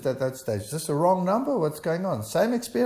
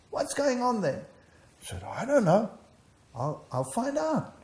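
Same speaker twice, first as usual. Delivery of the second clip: fear-struck; 10 semitones higher than usual. Retold or imitated. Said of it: "He was fear-struck".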